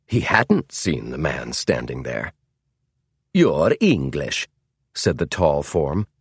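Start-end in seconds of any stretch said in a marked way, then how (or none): 3.3-4.5 put-on slow English with a Scandinavian accent. A narrator's reading. Tall stranger addressing James in the dark, Scandinavian accent. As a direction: speak it slowly, in a Scandinavian accent. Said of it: "The man's English had a Scandinavian accent".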